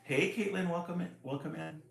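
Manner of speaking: usual speaking voice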